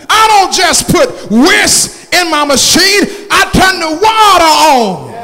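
high, slow shouted preaching; fiery preaching. Acted. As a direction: hammer each phrase to the pews. Preacher builds to climax.

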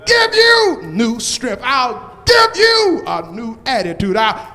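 loud rising preacher's call, building; preaching at full force. Acted. Pastor calling out to the congregation, preaching at full force. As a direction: whoop the promises at the crowd.

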